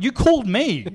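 you rang me, mate, come on; exasperated. Retold or imitated. To a confused caller, fed up and insistent.

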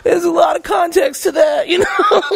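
high, laughing half-shout; cracking up while defending him; laughing high protest. Real, not acted.

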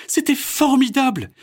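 put-on high, loud little-girl voice; bright-eyed child gushing; Mélanie's voice. A narrator's reading. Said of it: "dit-elle avec de grands yeux". (voix de fillette émerveillée)

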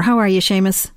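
ordinary delivery

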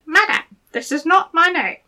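high squeaky little goblin voice; gravelly sly hobgoblin. Acted. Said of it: "he said".

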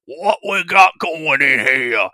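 slow, loud growling bugbear snarl. Acted. Bugbear snarling at the party over his gators. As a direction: snarl at the intruders like a hungry beast. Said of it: "snarl out".

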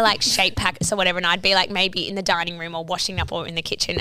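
ordinary talk

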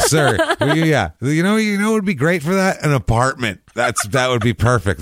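high, laughing mock politeness; high mock salesman. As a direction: play a smug helpful salesman. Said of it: "mock advice to buyer".

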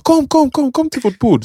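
very high, eager come come; mock high-pitched girl calling; excited invitation. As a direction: wave them over in a squeal. Girl voiced inviting them.

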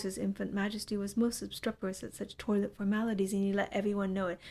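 ordinary delivery, nothing marked

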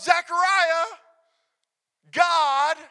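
high, loud angelic proclamation; booming announcement. Acted. Preacher as angel addressing Zechariah, loud proclamation. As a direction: call it out with big pauses.

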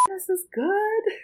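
high, drawn-out mock amazement; retold delight. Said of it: "I was like".

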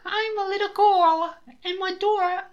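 put-on squeaky high Cockney child voice breaking into a laugh; mock Cockney girl, laughing. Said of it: "No, do that again".

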